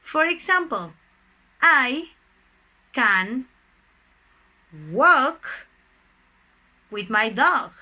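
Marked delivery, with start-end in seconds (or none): 1.6-7.7 isolated words with long gaps; word-by-word dictation. Shown as a demonstration. Teacher gives dog examples of can, word-by-word dictation. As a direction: set each word down separately, firmly.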